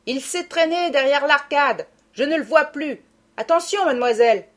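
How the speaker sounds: calling from window. A narrator's reading. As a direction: shout the warning from the window. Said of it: "Il lui cria de la fenêtre".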